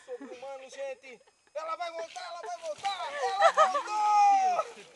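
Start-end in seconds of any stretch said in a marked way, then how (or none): 1.5-4.9 builds to a slow shout; rising play-by-play excitement; live commentary. Pretend.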